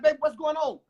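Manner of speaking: put-on a girlfriend jolted by the punch; startled girlfriend. Girlfriend, startled by the punch in the wall, high alarmed cry. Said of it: "She's like".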